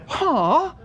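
high, loud baffled "Huh?"; a short puzzled yelp; mock puzzled huh. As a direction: jump up in confusion on the word. Puzzled at the film camera.

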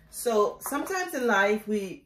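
ordinary talk

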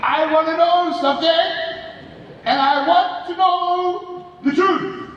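high, dragged-out motherly demand; hammed-up interrogating mom, stretching the words; confronting mother. Mom confronting her son at night, imitated demand.

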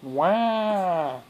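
put-on loud, high American-accented baby wail. Shown as a demonstration. Parent tests baby's American accent, mock American-accented wail. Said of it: "How's your American accent?".

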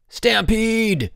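high, loud alarm yell. A narrator's reading. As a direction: yell it like a warning to a crowd. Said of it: "Bruno yelled".

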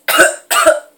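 sudden loud coughing fit; harsh cough. Real, not acted. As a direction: cough hard from the hot sauce. Coughing after eating hot sauce.

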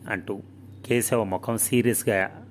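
usual speaking voice

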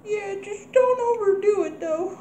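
high, crying motherly plea; tearful Ma. Acted. Mother begging her son not to overdo drinking, high and tearful. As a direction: beg him not to overdo it.

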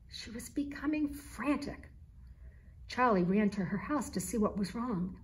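ordinary delivery

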